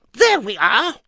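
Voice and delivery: very high squeaky Mister Nonsense; high silly voice. Acted. Narrator voicing Mister Nonsense, high and squeaky. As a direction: pipe it up like a daft little man.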